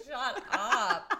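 high, loud, through laughter; mock outburst breaking into laughter; laughing retort. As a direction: crack up while telling them off. Mocks accent memory while cracking up.

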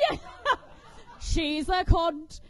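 normal talking voice

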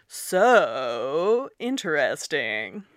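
slow, drawn-out sarcastic "So interesting"; sarcastic "So interesting", stretched very slowly with a tilde-shaped up-down-up pitch; deep sarcasm. Shown as a demonstration. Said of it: "in, like, really deep sarcasm".

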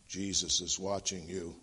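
low, quiet, ominous parrot. Acted. Parrot warning a robber in the dark, low parrot voice. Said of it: "it was the parrot talking".

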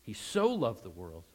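"so" lifted above the other words; single out "so" with a firm push; stress on so. Shown as a demonstration. Preacher restating the verse, clear stress on "so". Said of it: "emphasize so in your mind".

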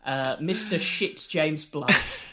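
ordinary talk, nothing marked

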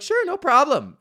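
sarcastic imitation of the shop staff; mock clerk. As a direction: mimic a fake-helpful clerk. Mocking the store clerks' reply.

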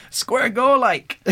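put-on high, loud laughing Glasgow accent; glasgow street accent. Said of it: "That's how she says it".

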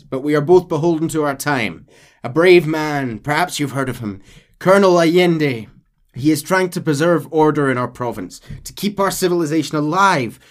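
De Santa praising Allende; lightly voiced captain. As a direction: speak with proud conviction. Voicing De Santa.

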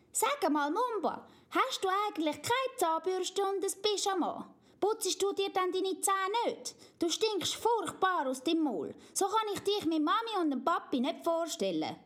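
put-on high child voice, mildly scolding; a little girl telling off her smelly friend; little girl Amalia, scolding. Amalia complains Mumba stinks, high scolding child voice.

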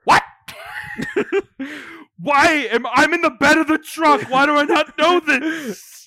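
loud high disbelieving questions; loud surprised outburst; incredulous. Real, not acted. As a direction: demand answers in shock. Surprised at creature chase.

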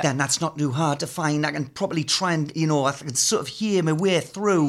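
lightly voiced Geordie presenter; Geordie accent. Shown as a demonstration. Showing the host an accent found through a celebrity, in Geordie. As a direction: slip into an easy Geordie accent. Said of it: "if I'm doing deck".

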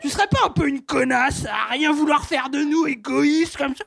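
loud aggressive shouted insult; belligerent drunk. Acted. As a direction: pick a fight, shouting. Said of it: "j'ai fait parler mes ovaires".

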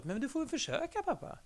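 put-on a small girl encouraging her dad gently; daughter's voice. The dad voicing his daughter telling him to just try, high child voice. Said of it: "min dotter som sa".

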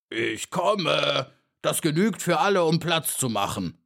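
put-on voice of a disgruntled beetle; grumpy grumbling. A narrator's reading. Beetle grumbling as he demands room in the grass, grumpy. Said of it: "missmutig brummen".

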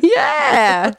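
laughing yeah. Real, not acted. Laughing agreement.

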